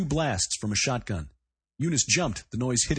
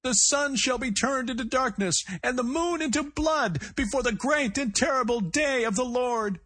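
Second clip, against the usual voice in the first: cracking strained cry; 13 semitones higher than usual. A narrator's reading. Gunman calling the prophecy from inside, voice cracking. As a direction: cry it out, voice fraying at the edge. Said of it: "cracking again with fatigue".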